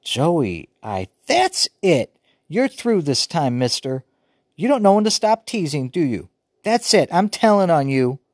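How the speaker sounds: high, loud and frantic; sounding crazy. A narrator's reading. Upset sister threatening to tell on brother, frantic. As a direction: near hysterical, voice climbing. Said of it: "she was sounding real crazy".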